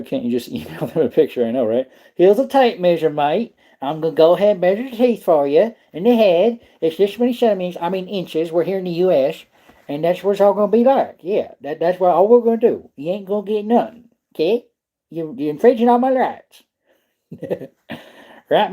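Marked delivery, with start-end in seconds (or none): 2.1-14.7 higher, hammy Texan voice lecturing the wildlife officers; put-on Texas drawl. Mocking the Game and Fish office in a hammed-up Texan accent. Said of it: "Sound like you're from Texas". 15.1-16.6 higher, indignant hammed-up Texan; put-on Texas accent. Mocking the officers with indignant Texan accent. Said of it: "Sound like you're from Texas".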